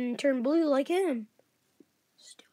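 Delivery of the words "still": barely audible whisper. Real, not acted. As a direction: whisper the joke again. Whispered joke aside.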